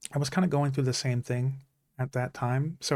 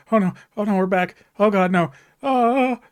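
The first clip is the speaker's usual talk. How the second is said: loud, high mock panic, much higher and louder; retold panic; 8 semitones higher, 10 dB louder than usual. Recalling the movie's ending to viewers, loud mock panic. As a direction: replay the panic attack as a half-joking loud high wail. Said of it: "I immediately fell into a panic attack".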